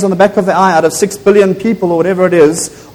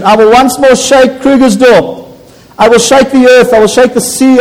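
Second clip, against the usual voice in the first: prophetic proclamation; 4 semitones higher than usual. Acted. Preacher declaring God's promise to the church, fervent. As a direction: thunder the promise over the church.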